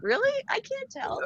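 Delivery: high, surprised little voice; sister's reply. Retold or imitated. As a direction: lightly voice the sister, unaware of any change. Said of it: "She's like".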